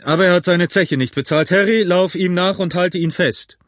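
calling out. A narrator's reading. Innkeeper orders Harry after the guest, calling out. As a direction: send a man running with the order. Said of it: "rief Silver".

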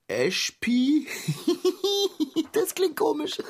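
high giggle breaking into the spell; laughing at the strange sounds; giggling. Acted. Giggling at the strange spell words.

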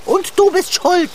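high, loud blame; shrill accusation; accusing outburst. Acted. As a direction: point the finger and yell it.

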